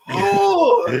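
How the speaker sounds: mock high yelps from the promo; imitated promo cries. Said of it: "I replayed that shit over and over".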